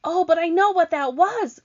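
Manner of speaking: high, loud excited outburst; eager cry. Acted. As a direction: blurt out eagerly. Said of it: "cried James".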